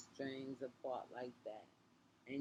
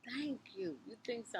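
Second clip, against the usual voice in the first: much higher, pleased acted voice; retold flattered reply; 9 semitones higher than usual. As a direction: play being flattered, pitched right up.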